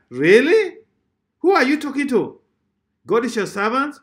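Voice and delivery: high, loud disbelief; incredulous rebuke. Acted. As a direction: challenge them in indignant questions. Rebukes those who command God.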